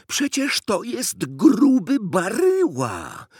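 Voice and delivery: put-on loud, very high, drawn-out boyish exclamation; excited cry. A narrator's reading. Boy recognises the fat president, crying out. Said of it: "krzyknął Konrad".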